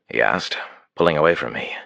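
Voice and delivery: ordinary delivery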